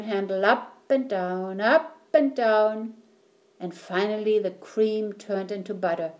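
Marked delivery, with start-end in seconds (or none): none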